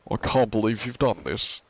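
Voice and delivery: light mock British accent. Streamer retrying the British demonstration, British accent. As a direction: attempt British with long vowels. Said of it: "I can't do a British".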